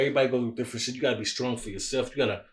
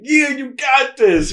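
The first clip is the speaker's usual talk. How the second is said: much higher, slow, drawn-out cheer; self hype; 12 semitones higher, 9 dB louder, slower than usual. Retold or imitated. Man cheering himself on in a pep talk, excited. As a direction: pump yourself up, yeah you got this. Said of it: "I get so excited when I motivate myself".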